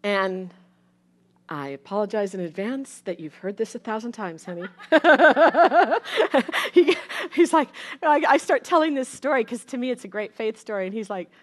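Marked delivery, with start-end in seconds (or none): 4.7-6.7 a loud, light burst of laughter; amused laugh. Real, not acted. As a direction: laugh at yourself, caught out. Laughing at husband's reaction.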